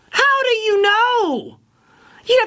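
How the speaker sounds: how do you know, stretched high and slow; incredulous. Real, not acted.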